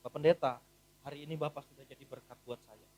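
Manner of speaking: low, soft heartfelt thanks; quiet grateful words to the pastor; sincere thanks. Retold or imitated. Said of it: "lalu saya bilang".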